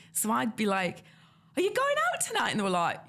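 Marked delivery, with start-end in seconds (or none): 1.5-2.5 very high, over-keen mock question; exaggerated high-pitched eagerness; too enthusiastic. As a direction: squeal it like an eager newcomer. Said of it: "I just felt too enthusiastic".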